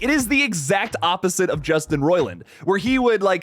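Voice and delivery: usual speaking voice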